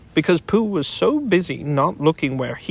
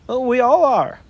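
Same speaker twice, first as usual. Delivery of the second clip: Rabbit; 9 semitones higher, 5 dB louder than usual. Acted. Rabbit voiced in story.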